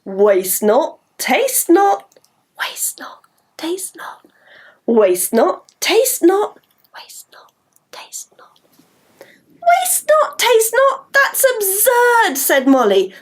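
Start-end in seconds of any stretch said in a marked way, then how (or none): 2.6-4.2 whispered rhyme; voiceless chant. Shown as a demonstration. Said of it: "Shall we try?". 6.9-8.6 whisper. Shown as a demonstration. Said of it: "Shall we try?". 9.2-12.4 high, slow disbelief; high incredulous girl voice. Acted. Molly scoffs at rhyme.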